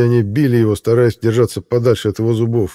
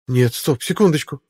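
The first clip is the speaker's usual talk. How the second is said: put-on young woman voice; 4 semitones higher than usual. A narrator's reading. Zoe interrupts the bear.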